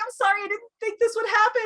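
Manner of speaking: high, fast gushing sorry; hammed-up rushed apology; mock romance apology. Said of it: "Where people are like".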